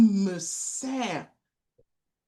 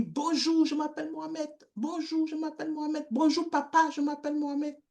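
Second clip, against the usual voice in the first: voix d'enfant polie; 8 semitones higher, faster than usual. Retold or imitated. Enfant se présente à la famille.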